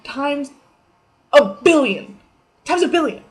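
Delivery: loud, high punch on billion; emphatic exaggeration. Vlogger exaggerating her imagination, loud. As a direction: blurt it out big.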